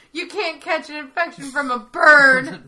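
hammed-up indignant protest; indignant retort. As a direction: act out an exasperated boss, half laughing. Retold indignant retort to coworker.

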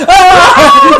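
high loud laughing shriek; Creed scream. Retold or imitated. Friends recalling Creed's face, laughing mock shriek. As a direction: shriek like a ghoul. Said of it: "the shot of Creed going".

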